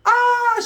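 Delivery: very high, loud mock scream; imitated woman's scream. Girlfriend as the shooting starts, imitated scream. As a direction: shriek like the panicked girlfriend. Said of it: "she's screaming".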